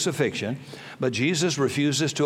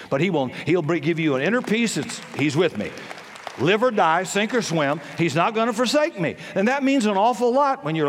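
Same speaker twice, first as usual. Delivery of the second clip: full-force preaching; 7 semitones higher than usual. Acted. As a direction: thunder it out from the pulpit.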